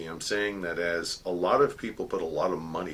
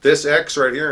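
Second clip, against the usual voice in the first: commander's firm order; 7 semitones higher, 10 dB louder than usual. Retold or imitated. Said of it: "And she goes".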